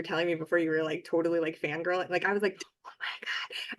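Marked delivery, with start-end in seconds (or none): 2.9-3.8 breathless whispered awe; susurro de fan. Retold or imitated. Retells fangirl gushing over author.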